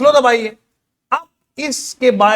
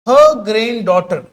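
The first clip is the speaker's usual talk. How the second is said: very slow, word by word, like dictating; slow dictation reading; much slower than usual. Shown as a demonstration. Teacher reading the exam question to students, slow dictation. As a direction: spell the question out slowly for the class.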